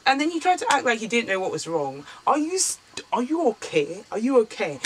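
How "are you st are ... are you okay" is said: higher, disbelieving are you okay; incredulous retort. Retold or imitated. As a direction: throw it back at him in disbelief. Retorting his pretend ignorance, incredulous.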